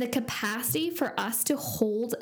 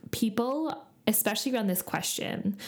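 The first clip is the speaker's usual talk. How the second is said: normal talking voice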